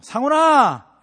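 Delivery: loud high call of a name; calling out a child. Retold or imitated. Husband calling wife by child's name, loud call. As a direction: shout the kid's name. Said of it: "자녀 이름 부르잖아요".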